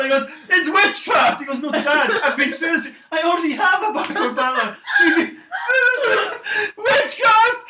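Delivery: high, loud hysterical dad; father screaming at his son; ranting father. Acted. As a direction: shriek like a panicked zealot. Father ranting about witchcraft.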